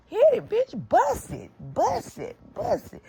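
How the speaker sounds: very high, loud taunting shout; shrieked dare, fading at the end; daring yell. Retold or imitated. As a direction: dare her to smash it. Daring her to break window.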